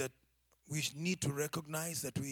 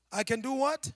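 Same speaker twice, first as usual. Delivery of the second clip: louder prompt; 6 semitones higher, 7 dB louder than usual. Shown as a demonstration. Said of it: "can you read it aloud".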